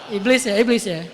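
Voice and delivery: loud emphatic repeat. Real, not acted. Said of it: "loud emphasis".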